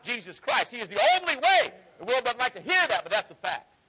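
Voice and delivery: high, loud rising sermon; preaching at full force. Acted. Preacher warning comfortable believers, rising high and loud.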